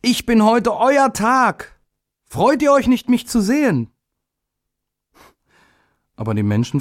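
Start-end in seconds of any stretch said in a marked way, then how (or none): 0.0-3.9 the little day asks people to be glad; eager little day. A narrator's reading. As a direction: sing it out hopefully like a small child.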